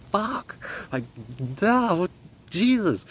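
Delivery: high-pitched flustered swearing; reenacted alarm; retold panic.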